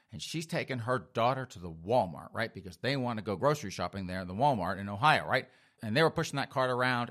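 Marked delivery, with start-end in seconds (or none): none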